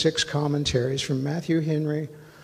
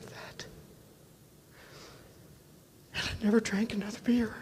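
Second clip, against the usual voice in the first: a sudden unvoiced whisper, pitched up; 7 semitones higher, 8 dB quieter than usual. Real, not acted. Testifying to the church about quitting drink, whispered. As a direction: let the line fall to a moved whisper.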